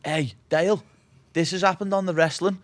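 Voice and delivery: loud and high, calling upstairs; shouting up. Retold or imitated. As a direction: yell up the stairs to Dale. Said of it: "shouting up".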